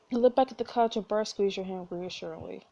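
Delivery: ordinary delivery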